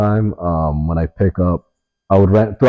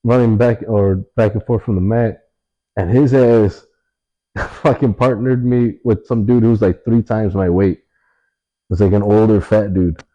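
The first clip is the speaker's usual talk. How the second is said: ordinary delivery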